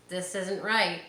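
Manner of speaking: usual speaking voice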